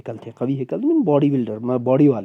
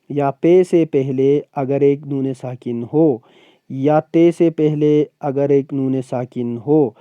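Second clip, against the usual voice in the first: slow, deliberate teaching pace; teacher dictating grammar rules slowly; slow rule list; slower than usual. Shown as a demonstration. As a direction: spell out each rule slowly. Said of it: "teacher lists rules slowly".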